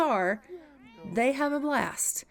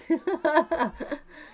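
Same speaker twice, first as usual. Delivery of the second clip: laugh; 5 semitones higher, 3 dB louder than usual. Real, not acted. Laughing at own bit.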